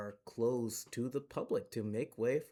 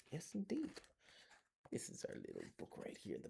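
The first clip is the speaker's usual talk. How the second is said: whisper; 7 semitones higher, 10 dB quieter, faster than usual. Real, not acted. Whispered aside.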